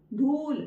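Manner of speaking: धूल with a lengthened, firm ऊ; long ऊ. Shown as a demonstration. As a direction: take time and force on the ऊ of धूल. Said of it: "time ज्यादा लगा".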